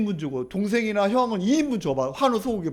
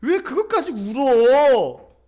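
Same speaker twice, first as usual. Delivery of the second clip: loud, high, drawn-out protest; dismissive husband; 5 semitones higher, 7 dB louder, much slower than usual. Acted. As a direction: brush off her tears with exaggerated disbelief. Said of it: "그까짓 거 가지고 우냐고".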